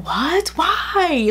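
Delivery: high, startled what and why; lightly voiced mom, caught off guard; mom's shocked question. Mom hearing her daughter will be fought, shocked and high.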